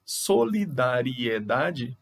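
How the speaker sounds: "Solidariedade" stretched out slowly, syllable by syllable; syllables split out. Shown as a demonstration. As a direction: spell "Solidariedade" out in slow syllables. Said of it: "então, é um ditongo". ("Solidariedade" bem devagar, sílaba por sílaba)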